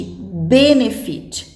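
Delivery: "benefit" with the first syllable, ben, stronger; stress on BEN. Shown as a demonstration. Teacher giving an example verb, "benefit" stressed on ben. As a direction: lean on the start of "benefit": BEN-efit. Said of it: "Nós falamos benefit".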